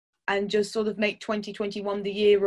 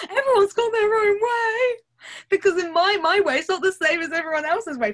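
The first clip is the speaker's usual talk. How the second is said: laughing; 11 semitones higher, 4 dB louder than usual. Acted. Answering as sensei, laughing.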